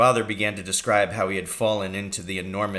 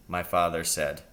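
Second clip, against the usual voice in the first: usual speaking voice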